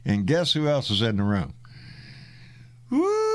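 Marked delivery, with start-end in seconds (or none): 2.9-3.3 high excited cheer; excited whoop. Real, not acted. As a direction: whoop it up in welcome. Excited welcome to Randy.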